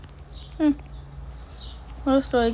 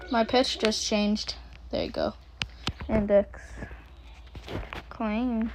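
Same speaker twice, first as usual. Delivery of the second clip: normal talking voice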